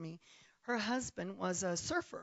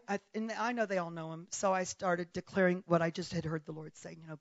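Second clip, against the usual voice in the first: usual speaking voice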